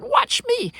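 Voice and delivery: high, loud boast; boastful. Acted. Wolf boasts to Turtle, high loud boast. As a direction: brag before you sprint.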